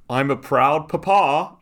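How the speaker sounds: high, loud, slow smug dad; mock proud papa. Quoting the father's post to the co-host, in a hammed-up proud dad voice.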